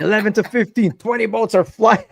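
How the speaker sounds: calling out boat numbers. Retold or imitated. Retells calling out boat flights.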